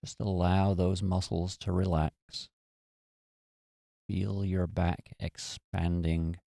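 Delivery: calm, unhurried meditation voice with long pauses; guided meditation. Real, not acted. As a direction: gently guide them into relaxation, leaving space after each phrase.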